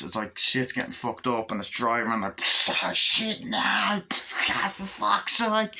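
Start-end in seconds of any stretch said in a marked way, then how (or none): 2.7-5.7 panicked cursing. Acted. Driver panicking mid-crash.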